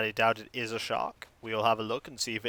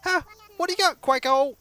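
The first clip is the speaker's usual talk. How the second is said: higher, louder villager voice; 12 semitones higher, 4 dB louder than usual. Acted. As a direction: chirp the villager's question up high. Voices game villager lines.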